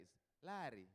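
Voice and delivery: "Larry" with a rolled Luo R, falling; village Luo accent. Shown as a demonstration. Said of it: "Do you notice the difference?".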